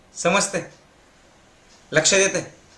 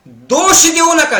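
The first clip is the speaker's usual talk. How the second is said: slow, high and loud; loud, slow preaching command; emphatic command; 11 semitones higher, 7 dB louder, slower than usual. Acted. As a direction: hammer home each word.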